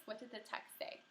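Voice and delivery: ordinary delivery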